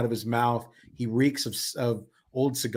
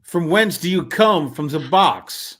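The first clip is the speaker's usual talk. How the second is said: slow, higher and louder, grand delivery; theatrical carnival man; 6 semitones higher, 8 dB louder, slower than usual. Acted. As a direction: question a strange newcomer with slow ceremony. Voices a game character.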